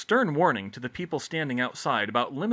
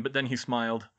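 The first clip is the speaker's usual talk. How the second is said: plain reading voice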